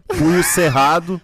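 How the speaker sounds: slogan de protesto, bradado devagar; shout. Pretend. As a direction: proclaim it like a rally chant. Brincadeira de resistência, punho erguido. (grito de protesto)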